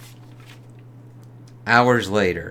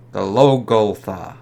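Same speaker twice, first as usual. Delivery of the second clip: ordinary talk, nothing marked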